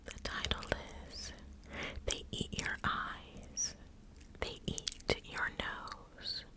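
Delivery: ordinary delivery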